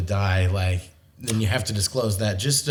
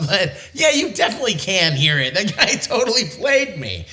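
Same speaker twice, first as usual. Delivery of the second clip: laughing through the words, pitched up; laughing at being fooled; 9 semitones higher, 4 dB louder than usual. Real, not acted. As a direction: chuckle while admitting you got played.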